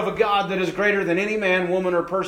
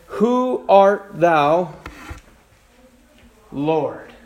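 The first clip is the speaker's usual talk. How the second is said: slow awed question; 2 semitones lower, much slower than usual. Acted. Saul on the ground asking the voice, slow and awed. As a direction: draw out each word, awed and unsure.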